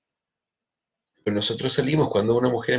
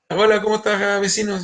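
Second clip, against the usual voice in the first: brighter, higher greeting as the neighbor; a lightly raised neighborly hello; voicing the neighbor; 7 semitones higher than usual. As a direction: play the friendly neighbor at the door.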